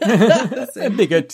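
laughing, slow and drawn out. Real, not acted. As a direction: say it through a laugh. Laughing at the odd word.

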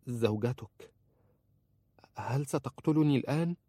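timid voice lifting high into the question; hesitant, afraid. A narrator's reading. Wife answers husband holding a knife, hesitant and afraid. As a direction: ask it fearfully, rising in pitch. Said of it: "قالت في تردد وخوف".

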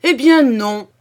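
loud, high cry of refusal; defiant outcry. A narrator's reading. The count refuses to give in to his son, loud defiant cry. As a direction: shout the no, refusing to yield. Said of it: "s'écria monsieur de Comarin".

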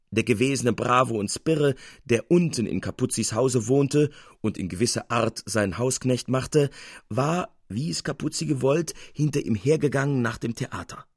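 ordinary delivery

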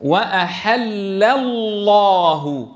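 very slow joined "وأحل الله", lam thin in "وأحل", thick in "الله"; recited "وأحل الله", long lams, first thin and second full; light lam, heavy lam. Shown as a demonstration. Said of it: "تبقى مرققة".

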